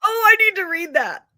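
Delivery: high, loud excited yelp; eager. Real, not acted. Excited reply to a friend's book tip. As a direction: jump at the recommendation.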